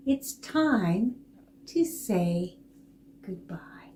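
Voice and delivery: soft unvoiced goodbye; hushed goodbye to kids; whisper. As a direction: breathe it in a whisper. Whispered ending for children.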